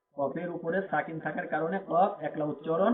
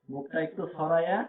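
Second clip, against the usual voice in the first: ordinary delivery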